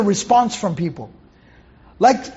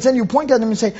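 normal talking voice

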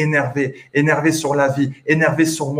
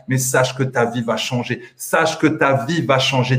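ordinary talk, nothing marked

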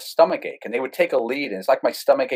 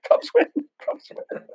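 breathless whispered cheer through a laugh; giddy laughing whisper; slower than usual. Retold or imitated. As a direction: gleefully whisper the win while laughing. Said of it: "I'm like".

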